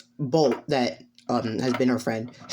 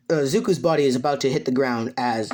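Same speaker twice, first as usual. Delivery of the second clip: ordinary delivery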